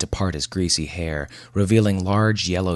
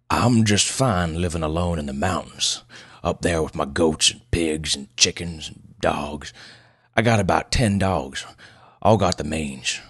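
put-on gruff old man. Old loner tells doctor of mountain life, gruff. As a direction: ramble roughly like an old backwoods hermit. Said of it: "Pleasanton's voice was as gruff as his appearance".

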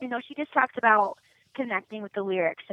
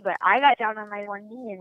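usual speaking voice

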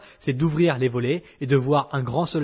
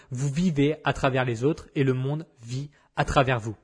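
ordinary talk

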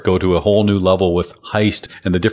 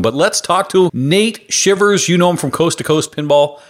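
announcer voice; 7 semitones higher than usual. Acted. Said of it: "I heard you go into it".

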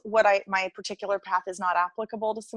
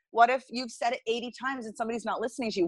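ordinary talk

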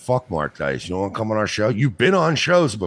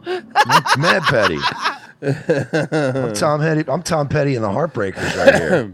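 laughing; 6 semitones higher, 4 dB louder, slower than usual. Real, not acted. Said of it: "I'm petty".

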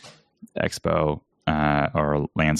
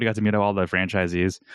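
ordinary talk

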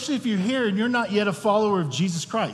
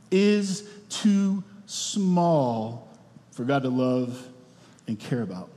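slow tender emphasis; 4 semitones lower, slower than usual. Acted. Preacher slows on key line.

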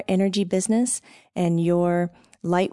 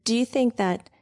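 usual speaking voice

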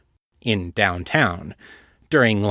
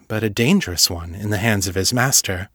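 usual speaking voice

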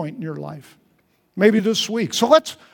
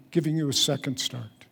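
normal talking voice

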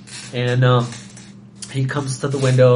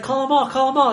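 high mock demand; 12 semitones higher than usual. Voicing guest's demand.

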